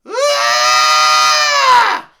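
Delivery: high, very loud sustained scream; long piercing "Ah" at full volume; droid scream. Acted. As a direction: shriek like a fleeing little droid.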